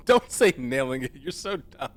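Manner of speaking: loud scolding through a giggling fit; cracking up while telling them off; laughing. Real, not acted. Telling off a friend for being dumb, laughing.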